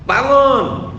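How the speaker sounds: loud, high barked command; wake-up shout. Acted. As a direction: imitate rousing sleepers with a shout. Menirukan bentakan membangunkan orang. (bentakan keras membangunkan orang)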